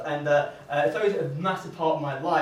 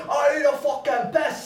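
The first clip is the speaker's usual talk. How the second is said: put-on slow, higher drunken bellow; drunk guy yelling; 7 semitones higher than usual. Festival drunk in the crowd, slurred mock bellow. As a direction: play the sloppy drunk demanding a toilet.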